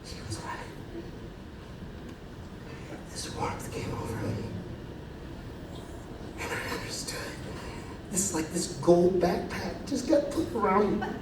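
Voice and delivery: breathy, voiceless whisper of revelation; hushed whisper. Acted. Remembering the moment he finally understood, whispered in awe. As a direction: whisper it as if awed by a sudden truth.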